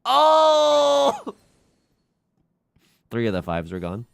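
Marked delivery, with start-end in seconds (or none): 0.1-1.4 shocked cry. Real, not acted. Shocked at a mahjong loss.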